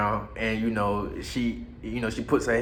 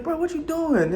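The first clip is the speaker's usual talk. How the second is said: friend's question; 14 semitones higher than usual. Retold or imitated. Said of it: "he like".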